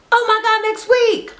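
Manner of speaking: high, bright repeat of "Oh my God, next week"; excited squeal. Real, not acted. Vlogger hyping next week's episode to viewers, high and squealing. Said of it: "I cannot wait".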